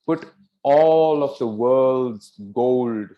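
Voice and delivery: loud, drawn-out sweeping declaration; grand flattery. Acted. First daughter flattering her father the king, grandly. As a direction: stretch ALL, WORLD'S and GOLD grandly.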